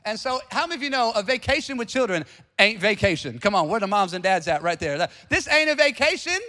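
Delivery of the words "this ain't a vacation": exclaiming. Real, not acted. Preacher exclaims to parents.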